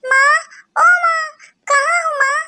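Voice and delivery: high, loud call across the house; calling out for mother. A narrator's reading. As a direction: call for mother from another room. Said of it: "माँ, माँ चिल्ला रही".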